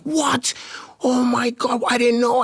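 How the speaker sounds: high, shocked squeal of a wife who just found out; surprised wife. Retold or imitated. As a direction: jump way up in pitch, stunned and delighted. Wife learns he won worlds.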